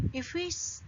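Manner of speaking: ordinary talk, nothing marked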